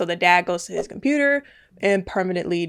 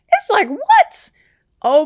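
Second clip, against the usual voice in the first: high, loud, rising "what?"; baffled outburst, pitched way up; incredulous; 13 semitones higher, 7 dB louder, slower than usual. Real, not acted. Baffled reaction to son's words.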